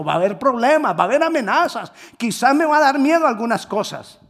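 high, loud preaching climax; fiery preaching. Acted. Preacher stirring the congregation, fiery preaching. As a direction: drive the point home.

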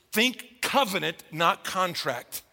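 covenant and contract drawn out slowly; slowed, weighty contrast; slow emphasis. Real, not acted. Preacher stresses key contrast.

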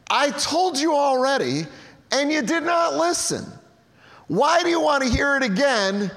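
a man tired of repeating himself; exasperated healed man. Acted. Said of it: "he answered and he said".

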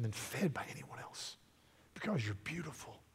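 starts soft, ends in a breathy whisper; fading to a whisper. Acted. Mare to Aslan, devoted, fading to a whisper. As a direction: let it sink into a whisper. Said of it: "Just so".

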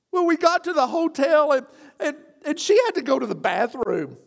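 high, fast flustered confession; crying husband. Retold or imitated. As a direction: blurt it out like a tearful young husband. Husband voiced in retold story.

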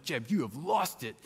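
self-directed disbelief, pitch lifting; mock alarm. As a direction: tell yourself off, half-panicked. Own mind scolding him in disbelief.

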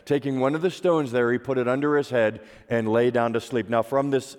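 usual speaking voice

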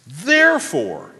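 Therefore drawn out slow, set apart by a pause; weighty, lingering Therefore before moving on; stress on THEREFORE. Shown as a demonstration. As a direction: land slowly and firmly on Therefore. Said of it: "emphasize a different word every time".

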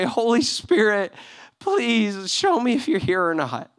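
slow, high, pleading prayer; scared prayer. Retold or imitated. Young missionary praying for a sign, scared. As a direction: retell a frightened plea, drawing the words out. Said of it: "I was really scared".

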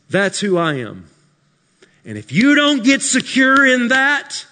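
loud, high preaching climax; full-force preaching. Acted. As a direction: thunder it to the congregation.

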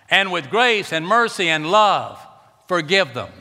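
loud, high, slow scripture preaching; full-force revival preaching. Acted. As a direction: thunder God's warning from the pulpit, word by word.

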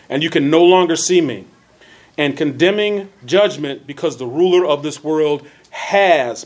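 measured reading with key words punched; emphatic, well-enunciated Bible reading; deliberate scripture reading. Real, not acted. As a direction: read scripture out with careful elocution. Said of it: "that's emphasizing certain words".

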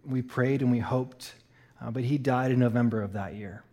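soft, low and grieving; subdued, heavy-hearted telling; somber. Real, not acted. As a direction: tell the loss quietly. Tells of his father's death.

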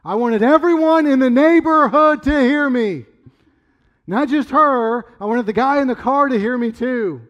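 loud, high, forceful declaration; emphatic shouting. Real, not acted. Father telling how he wanted the whole neighborhood to hear, shouting. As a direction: keep projecting as if the whole street must hear. Still at yelling force after retelling.

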